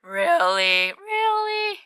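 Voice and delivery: surprised. Real, not acted. Surprise at finding Ivysaur.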